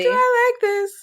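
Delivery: put-on high laughing sing-song girl voice; giggly Valley Girl squeal; bubbly sorority girl.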